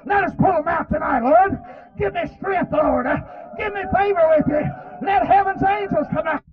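high-pitched pleading shout; impassioned, rising revival prayer; urgent prayer. Real, not acted. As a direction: cry out to God for strength. Crying out to God for strength.

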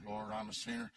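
low and flat, the words echoed without feeling; a dry, low, emotionless echo. Retold or imitated. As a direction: mumble it back low and dry. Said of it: "dry, dry, low voice".

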